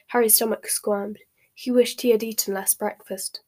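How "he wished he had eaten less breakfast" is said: usual speaking voice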